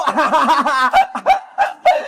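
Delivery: long laugh at tricked customers; mocking laugh. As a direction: laugh openly at their mistake.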